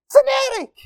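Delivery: very high squeal on fanatic; squeaky fanatic. Acted. Preacher to congregation, high squeaky joke voice. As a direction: squeal the word like a cartoon. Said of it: "which is short for".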